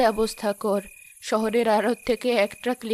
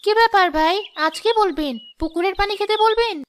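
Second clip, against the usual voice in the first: higher and louder, sarcastic jab; bitter sarcasm; 9 semitones higher, 4 dB louder than usual. Acted. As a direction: throw his cruelty back at him mockingly. Poor woman taunts repentant shopkeeper.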